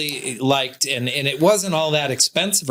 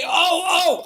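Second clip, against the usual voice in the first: put-on eager kid calling out; 13 semitones higher than usual. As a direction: beg the teacher to call on you. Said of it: "You would say".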